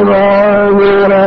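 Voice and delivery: hugely drawn-out syllables; exaggeratedly slow, stretched hello; mock slow greeting. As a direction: drag the greeting out endlessly. Mimics over-slow greeting to visitors.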